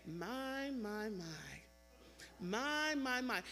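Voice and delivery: drawn-out "My", stepping down in pitch; imitated elder's slow, wondering refrain; musing exclamation. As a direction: look back, lost for words. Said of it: "She'd look back and she'd say".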